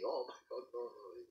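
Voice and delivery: soft, fearful murmur; terrified. Acted. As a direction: plead quietly in dread. King dreading being eaten.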